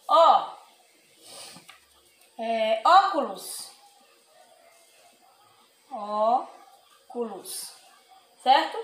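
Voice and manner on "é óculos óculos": óculos with an open ó, drawn out very slowly. Shown as a demonstration. Teacher slowly models the acute: open ó. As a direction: stretch óculos, opening the ó. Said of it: "ele muda o som da vogal".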